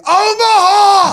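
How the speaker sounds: high, long, loud holler; drawn-out shouted play call; quarterback audible. Retold or imitated. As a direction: bellow it down the line, stretched out.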